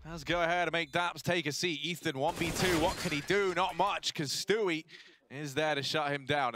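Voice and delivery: high, loud live commentary; excited play-by-play. Acted. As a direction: ride the action as it unfolds.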